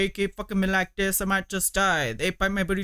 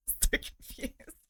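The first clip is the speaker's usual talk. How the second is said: whispered aside; 8 dB quieter, slower than usual. Real, not acted. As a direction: whisper it, slow and softer. Reacting after laughing.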